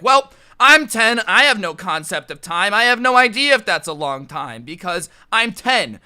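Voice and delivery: put-on loud, emphatic kid's protest; clueless kid's retort. Ten-year-old answering mom about old stairs, loud and clueless. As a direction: belt it out like an oblivious child.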